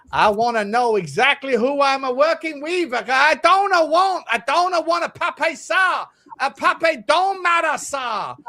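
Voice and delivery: high, slow, hammed-up Italian manager; mock Italian boss voice; generic Italian accent. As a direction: drag out the complaints like a cartoon Italian. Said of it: "massively generic, massively Italian".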